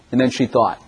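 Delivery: ordinary talk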